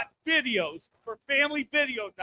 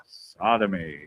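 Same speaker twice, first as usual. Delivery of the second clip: much lower, booming single word; deep narrator; slower than usual. Retold or imitated. Comic finishing the Morgan Freeman impression, very deep. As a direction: intone it like a solemn narrator. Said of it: "goes over".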